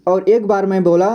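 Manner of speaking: usual speaking voice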